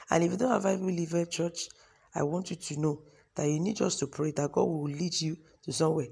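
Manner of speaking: usual speaking voice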